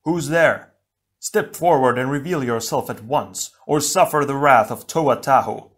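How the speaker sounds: loud, commanding hero's demand; a fiery hero daring the enemy forward; bold challenge. Acted. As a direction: shout the threat proudly into the cavern. Tahu challenges hidden foe.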